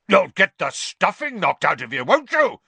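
a threatening roar rising to a shout. A narrator's reading. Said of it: "roared Uncle Vernon".

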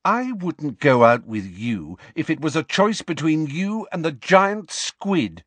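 high-pitched scornful refusal. A narrator's reading. Lily rejects James's offer of a date, scornful. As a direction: turn him down with contempt.

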